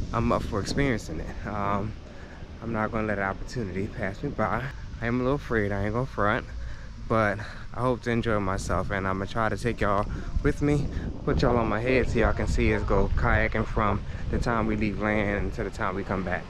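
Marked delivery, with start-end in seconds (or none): none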